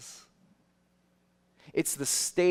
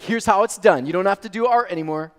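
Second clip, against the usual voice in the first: loud, fast, higher smug takeover; louder, faster show-off parent; know-it-all; 6 semitones higher, 12 dB louder, faster than usual. Retold or imitated. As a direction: brush her aside like an expert. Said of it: "be like".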